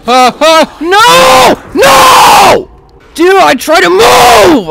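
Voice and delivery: very high, loud cries of No; real despair as he goes down; despairing shout. Real, not acted. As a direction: scream it in despair. Getting downed.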